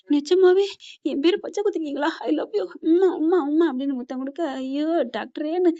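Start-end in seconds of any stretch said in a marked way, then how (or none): 0.1-2.7 overjoyed wife. Retold or imitated. Wife sees her name tattooed. 2.8-3.7 three quick loud kiss sounds, pitched high; smacking kisses. Retold or imitated. Wife kissing husband in joy, loud playful kisses.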